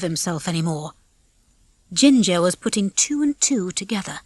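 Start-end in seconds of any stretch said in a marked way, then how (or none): none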